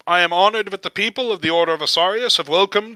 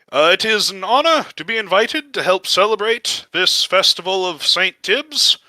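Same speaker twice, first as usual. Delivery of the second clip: higher-pitched voice of a paladin addressing a crowd; bewildered guest speaker; 5 semitones higher than usual. Acted. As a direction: give a public speech as if caught off guard. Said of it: "a bit bewildered".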